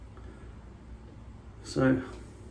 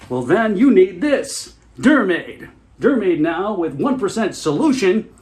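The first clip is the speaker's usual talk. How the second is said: slow, high, loud infomercial voice; pitching a scab cream with mock enthusiasm; hammed-up TV ad; 6 semitones higher, 5 dB louder, slower than usual. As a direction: sell it like a cheesy late-night commercial.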